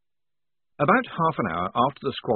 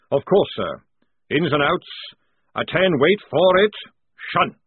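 parade-ground bark; 6 semitones higher, 7 dB louder than usual. A narrator's reading. Jackram worried then commanding.

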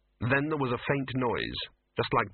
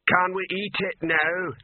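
troll voice; 8 semitones higher, 4 dB louder, slower than usual. A narrator's reading. Troll asking.

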